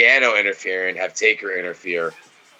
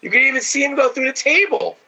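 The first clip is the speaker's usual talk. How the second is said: outraged. Real, not acted. Outraged at camera work.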